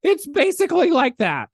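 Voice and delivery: high, loud, over-dramatic caller; frantic insistence. Retold or imitated. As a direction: insist it's a dire emergency, hammed up. Retold patient insisting it's an emergency.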